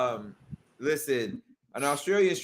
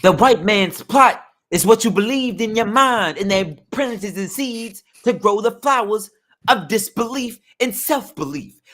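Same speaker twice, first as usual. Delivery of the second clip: much higher conspiracy-preacher voice; Bam impression; 9 semitones higher, 4 dB louder than usual. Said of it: "That's not Bam?".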